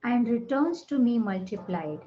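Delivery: calm, spacious meditation read; guided meditation. Acted. Leads affirmations over music.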